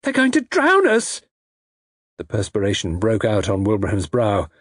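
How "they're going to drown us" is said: very high, panicked cry; hysterical cry. A narrator's reading. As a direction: cry out in terror, pitched right up. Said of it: "Frida gave a hysterical cry".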